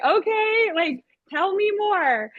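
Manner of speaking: high, drawn-out coaxing; playful puppet reply. Retold or imitated. Teacher prompting the boy to go on, high playful voice. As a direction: coax a child in a high little voice.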